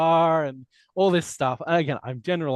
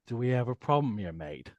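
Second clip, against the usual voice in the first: low, soft menace on the final mate; low, quiet threatening mate; 5 semitones lower, 5 dB quieter than usual. Shown as a demonstration. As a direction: quietly warn him off, mate. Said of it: "the threatening mate".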